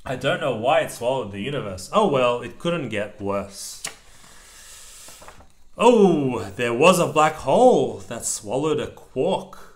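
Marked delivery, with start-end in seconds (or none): none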